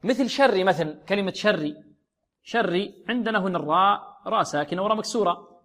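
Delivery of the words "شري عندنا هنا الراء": usual speaking voice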